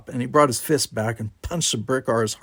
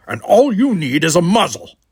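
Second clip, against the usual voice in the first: loud, raised angry retort; snapped; 6 semitones higher, 8 dB louder than usual. A narrator's reading. Worf throws the insult back at Brickar, snapping. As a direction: snap back, higher and louder. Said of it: "he snapped".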